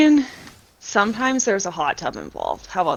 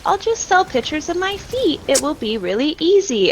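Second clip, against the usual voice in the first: high, light mock-naive voice; naive wannabe; 9 semitones higher than usual. Streamer mimicking people with a big misconception, mock naive. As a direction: quote a clueless hopeful, pitched well up. Quoting naive would-be sellers.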